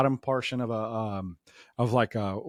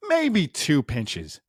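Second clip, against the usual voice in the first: ordinary talk, nothing marked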